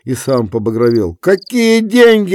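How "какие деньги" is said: high, slow furious shout; angry shout. A narrator's reading. Kuzma flares at Efrem, high furious shout. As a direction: yell it back. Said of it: "закричал он".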